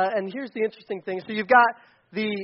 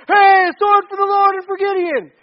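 high, full-force battle cry; yelling; 11 semitones higher, 10 dB louder than usual. Acted. Preacher as Gideon's men smashing pots, yelling the war cry. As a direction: yell it like a charging army. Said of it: "and they're yelling".

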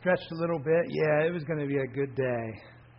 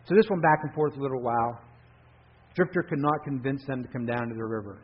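plain reading voice

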